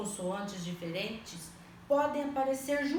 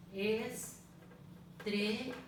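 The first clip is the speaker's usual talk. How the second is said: Estrear said softer, split into syllables, Es-tre-ar; softly syllabified; 5 dB quieter than usual. Shown as a demonstration. Teacher to pupils, syllabifying softly. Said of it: "Estou separando em sílabas".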